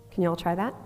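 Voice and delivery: normal talking voice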